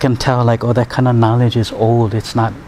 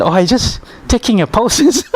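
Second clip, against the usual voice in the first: higher, laughing as you say it; amused retort; 11 semitones higher than usual. Retold or imitated. Healer teases the patient that he only took pulses, higher and laughing. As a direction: tell him he hasn't started yet, amused. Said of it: "I said".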